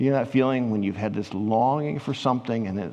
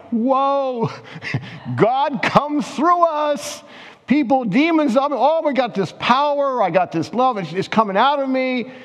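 high, thrilled disciples whooping with a laugh; much higher excited voice, bursting with wonder; stoked; 11 semitones higher than usual. Retold or imitated. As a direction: gush like amazed disciples back from a mission. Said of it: "like, stoked".

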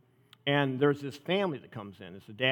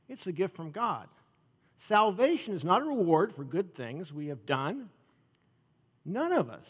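scripture reading; 5 semitones higher than usual. Acted. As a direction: lay out each phrase of the verse deliberately. Reads scripture to congregation.

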